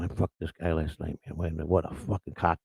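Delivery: a soft, hushed mock imitation of women gossiping; lightly voiced gossiping woman, hushed. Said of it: "They're, you know".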